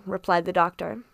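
ordinary delivery, nothing marked

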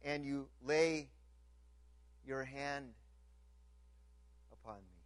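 slow, soft, fading to a hush; tender reading. Real, not acted. Pastor savouring a favourite verse, slow and soft. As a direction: linger softly over the promise. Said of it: "I love this line".

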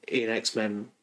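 ordinary talk

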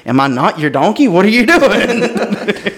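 slow, high, drawn-out indignant donkey voice; talking donkey. Retold or imitated. Balaam's donkey complaining to him after being struck, high and drawn out. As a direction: plead like a baffled donkey. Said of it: "the donkey turns around and says".

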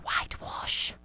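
nagging whisper of conscience. Acted. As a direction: hiss it like a guilty thought.

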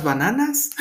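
"bananas" pronounced as if it were Spanish; Spanish accent. Shown as a demonstration. Giving an example of his Spanglish slip, Spanish accent. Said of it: "But with the Spanish accent".

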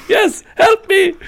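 slow, high, loud falsetto begging; imitated plea for help. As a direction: mimic a desperate player crying out. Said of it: "He's like".

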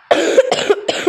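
short harsh cough; coughing fit. Real, not acted. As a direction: cough sharply mid-game. Sick gamer coughing.

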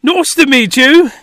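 hammed-up fake British accent; terrible British accent. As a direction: do a bad British guest apologizing. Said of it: "terrible British accent".

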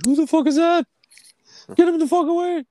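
put-on very high, loud voice of a young cat; Rambo. Young cat ordering the stranger at the window away, loud high squeal. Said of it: "My cat, Rambo, he's, like, just young".

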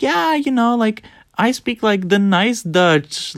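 high put-on voice easing down; mocking high-pitched imitation; mimicked explainer. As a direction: imitate them explaining, starting very high and slowing. Mimics the other person explaining.

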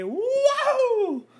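very high falsetto "woo"; long laughing whoop. Pretend. Capping the New York movie bit, high whoop.

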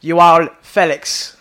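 mock Portuguese. Mocking an American YouTuber's Portuguese, Portuguese accent. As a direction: Joao Felix drawn out, Portuguese style. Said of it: "the way he pronounces Joao Felix".

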